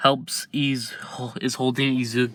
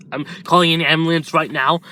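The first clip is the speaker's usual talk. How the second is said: ordinary delivery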